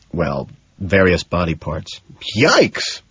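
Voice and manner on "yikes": sharp high yelp of alarm; exclaiming. A narrator's reading. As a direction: blurt it out, startled and high. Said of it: "I exclaim".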